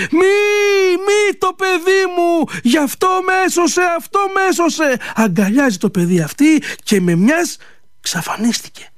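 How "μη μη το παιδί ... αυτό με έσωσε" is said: put-on a mother begging for her child; desperate cry. Fairy bride crying out to save her child, high and desperate. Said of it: "Βάζει, λένε, αυτή μια φωνή".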